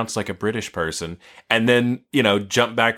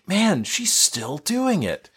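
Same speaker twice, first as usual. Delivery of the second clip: exasperated disbelief; 8 semitones higher, much slower than usual. Retold or imitated. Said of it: "I was going like".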